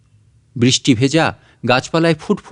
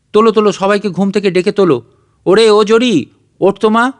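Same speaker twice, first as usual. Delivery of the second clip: higher, louder, fast joyful call to wake everyone; excited calling out; 5 semitones higher, 4 dB louder than usual. Acted. Father calls the sleeping children awake, excited and fast. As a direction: rush the words, calling the kids up with glee.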